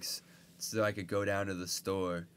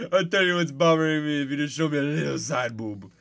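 put-on loud, high Indian-accented wheedling; 5 semitones higher, 10 dB louder than usual. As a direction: bargain in a hammed-up Indian voice. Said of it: "it's an Indian guy".

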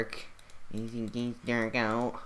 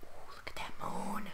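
nervous hushed whisper; whisper, scared of being heard; worried whisper; 5 semitones higher, 6 dB quieter than usual. Acted. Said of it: "Shh, shh, be quiet".

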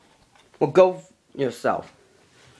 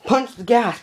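put-on kids yelling; 9 semitones higher than usual. Kids in the truck urging the driver on, yelling high. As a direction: shout it like a kid egging on the driver. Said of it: "The kids start yelling".

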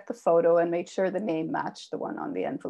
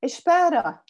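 sharp loud call, pitched high; loud Portuguese shout of wait; yelled out; 9 semitones higher, 8 dB louder than usual. Acted. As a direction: call after them to stop. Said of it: "she yelled out".

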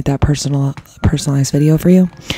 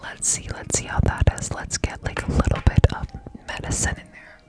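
ear cleaning asmr; 3 semitones higher than usual. Real, not acted.

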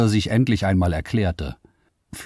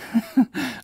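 a burst of disbelieving laughter, very high; sudden high laugh. Acted. Liara laughs at the men, sudden disbelieving laugh. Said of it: "Plötzlich lachte Liara auf".

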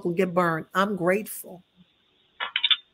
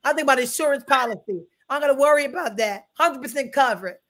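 higher, louder call-and-response preaching; preaching at full force; 5 semitones higher, 5 dB louder than usual. Acted. Pastor preaching to the church about being covered, fervent and raised. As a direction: drive it home to the congregation.

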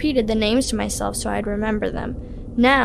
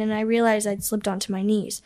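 ordinary delivery

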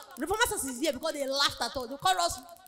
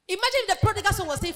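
exhorting; 5 semitones higher, 5 dB louder, faster than usual. Acted. Preacher lifts voice to exhort.

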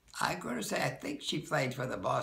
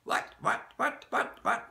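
imitating a flustered British teacher's rapid "what, what"; mock-exasperated British accent, one what leaping high; 13 semitones higher, 3 dB louder than usual. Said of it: "in that British accent".

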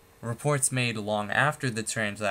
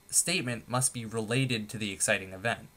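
usual speaking voice

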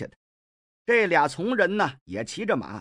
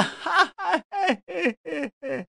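a long, much higher theatrical howl of weeping that falls away; wailing howl; 12 semitones higher than usual. Acted. Mi Heng howls in mock grief at the pavilion, high falling wail. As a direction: let out a big fake mourning howl. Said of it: "放声大哭啊".

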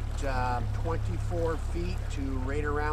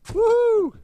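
loud high joyful whoop; 10 dB louder than usual. Real, not acted. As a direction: cheer the catch out loud. Landing the fish.

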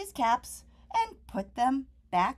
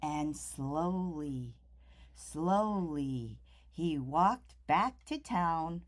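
slow, soft and low; 7 semitones lower, 8 dB quieter, slower than usual. A narrator's reading. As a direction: stretch the words out wearily. Peddler walks back slowly.

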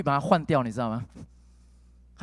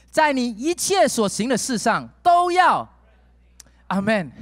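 louder, rising preaching voice leading prayer; fervent preaching; 6 semitones higher, 5 dB louder than usual. Acted. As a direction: build the congregation toward prayer. Preaching at full force. (热切讲道带领祷告)

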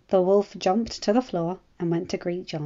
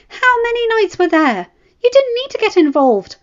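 much higher, louder angry shouting; wife furious at her reckless husband, shouting high; furious scolding wife; 11 dB louder than usual. A narrator's reading. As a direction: tear into him for risking his life.

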